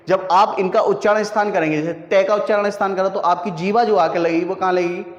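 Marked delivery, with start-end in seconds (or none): none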